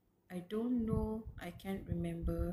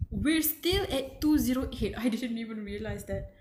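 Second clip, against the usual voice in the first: stunned disbelief; 3 semitones higher, 7 dB louder than usual. Real, not acted. Reader realizing how little she has read, stunned. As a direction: blurt it out in alarm.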